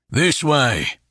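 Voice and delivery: a hearty, amused sellsword; Horn's voice. A narrator's reading. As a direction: play Horn teasing his boss. Horn's character voice.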